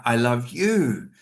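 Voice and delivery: slow, with "you" stretched and falling; heavy emphasis on "you", singling them out; stress on you. Shown as a demonstration. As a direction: draw out and punch the final "you". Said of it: "I'm giving emphasis to the you".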